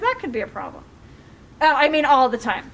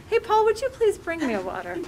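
higher, softer polite ask; polite request; 6 semitones higher, 4 dB quieter than usual. Shown as a demonstration. Speaker rewording a water request to Paul, politely. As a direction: ask sweetly, pitched up and gentle. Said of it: "Did you ask him politely?".

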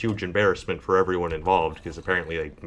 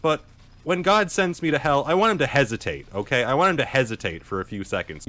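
emphatic rant; 7 semitones higher, 5 dB louder than usual. Real, not acted. Emphatic rant about God.

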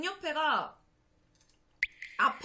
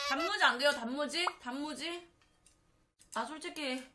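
usual speaking voice

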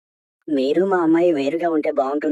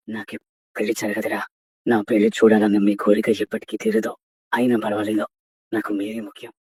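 sorrowful low sacrifice; sad resignation; 7 semitones lower than usual. Acted. Brother gives up marriage for siblings.

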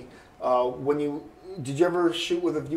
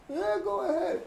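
high mock villager. Villager agreeing to watch bike.

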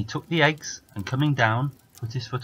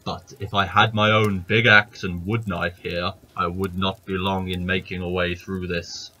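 giant muttering about his axe; 4 dB louder than usual. Acted. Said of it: "said the giant".

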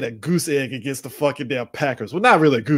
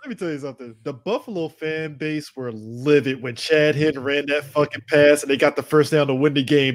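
ordinary talk

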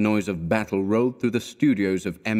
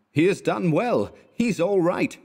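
shouting; 10 semitones higher than usual. A narrator's reading. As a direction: call it out high like a crowd's approval, without full volume.

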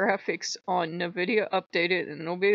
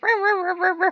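high, loud nonsense yapping; mock ranting noise; 13 semitones higher, 8 dB louder than usual. Imitating own rant at Loki, high mock yapping.

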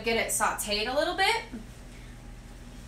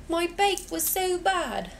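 slow, higher mock British accent; British accent impression; 8 semitones higher, slower than usual. Fan imitating Bake Off contestants in a British accent. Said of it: "Gavin doesn't think I have a good British accent".

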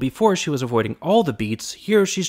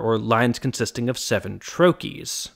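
usual speaking voice

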